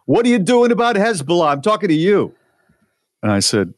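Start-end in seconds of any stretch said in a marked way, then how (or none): 0.1-2.3 high, pushy Brooklyn woman; imitated Brooklyn accent, pitched way up; thick Brooklyn accent. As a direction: confront a stranger in thick Brooklyn. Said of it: "she's got this thick Brooklyn accent".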